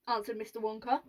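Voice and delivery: ordinary delivery, nothing marked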